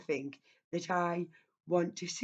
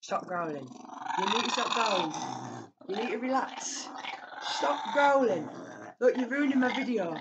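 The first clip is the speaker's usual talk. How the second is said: raised, exasperated telling-off, louder; scolding the dog; 4 semitones higher, 5 dB louder than usual. Real, not acted. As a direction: snap at the dog to stop. Scolding growling dog on camera.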